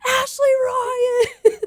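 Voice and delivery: high, slow, laughing shout; friends yelling her name. Retold or imitated. Friends in the pit calling to her on stage, laughing shout. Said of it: "all I heard were my friends going".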